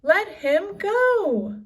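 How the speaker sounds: high, loud and slow; fierce demand. A narrator's reading. Annie charging the biggest pirate, high fierce demand. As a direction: order him to let go. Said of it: "Annie charged up".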